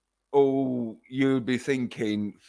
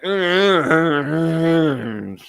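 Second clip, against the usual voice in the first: mock sleepy singer; 2 semitones higher, 3 dB louder, much slower than usual. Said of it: "she sounded like she fucking just woke up".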